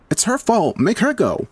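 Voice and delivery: high, accusing voice; blaming. A narrator's reading. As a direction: point the finger sharply. Heather blaming.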